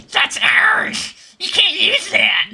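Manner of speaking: high, loud gremlin shriek, slow. Retold or imitated. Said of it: "how I imagine copyright holders sound".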